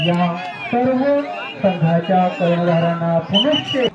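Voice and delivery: usual speaking voice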